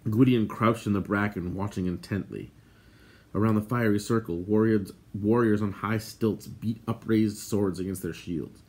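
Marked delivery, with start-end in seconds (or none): none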